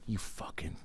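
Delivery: unvoiced, breathy muttered curse; whispered start of a pretend confrontation; hissed start. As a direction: seethe it under the breath.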